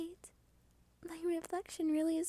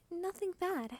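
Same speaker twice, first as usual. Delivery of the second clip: ordinary talk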